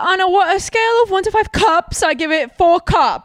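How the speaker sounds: high-pitched mock British accent. Quoting an album review, mock British accent. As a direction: put on a high British voice, drawing out the last words. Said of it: "Why is she British?".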